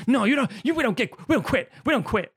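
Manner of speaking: high, loud, stubborn shout; defiant yell. Retold or imitated. Podcaster playing Ricky Bobby yelling that they don't quit. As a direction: shout it like you'll never quit.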